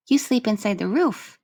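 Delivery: put-on high, bright child asking; a small girl wondering aloud; little girl voice. Child voice Lilica.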